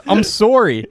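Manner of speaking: high, mock-Canadian apology; Canadian accent. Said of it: "like the most Canadian".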